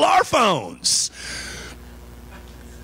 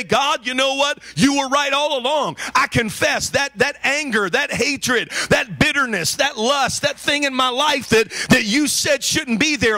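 preaching at full force; fervent prayer; 6 semitones higher than usual. Acted. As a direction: cry out a confession to the Lord. Praying aloud in the sermon.

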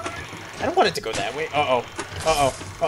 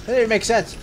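ordinary talk, nothing marked

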